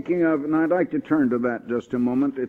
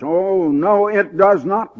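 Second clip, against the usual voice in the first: emphatic denial; 5 semitones higher, 8 dB louder, slower than usual. Real, not acted. Preacher rebutting a false belief.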